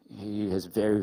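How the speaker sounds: normal talking voice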